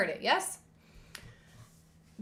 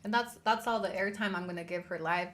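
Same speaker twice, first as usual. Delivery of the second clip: ordinary talk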